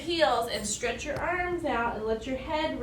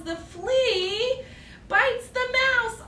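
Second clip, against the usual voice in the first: higher, louder and slow, building suspense; slow it down as the flea strikes; dramatic build-up; 9 semitones higher, 3 dB louder, much slower than usual. Acted. Telling children the flea bites, rising drama.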